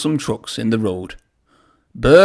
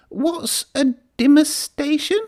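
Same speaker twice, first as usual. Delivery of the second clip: much higher, slow little engine voice; small engine voice. A narrator's reading. Percy asking what a demonstration is, small and high. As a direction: ask it like a timid little engine. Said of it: "asked Percy quietly".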